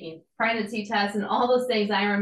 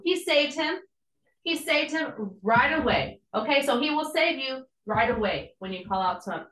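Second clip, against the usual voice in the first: ordinary delivery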